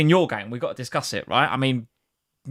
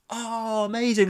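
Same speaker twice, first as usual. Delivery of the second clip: mock presenter excitement; 6 semitones higher, slower than usual. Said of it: "being really animated and being like".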